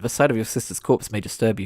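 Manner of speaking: ordinary delivery, nothing marked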